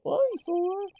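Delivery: incredulous high echo. Acted. Sidekick echoing the odd word in a high squeak. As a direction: bounce "Eyesore?" back in a high squeak.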